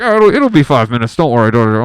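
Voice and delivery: very loud imitation of a technician; mock technician's call. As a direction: call out reassurance at full volume. Imitating scanner technician calling out reassurance.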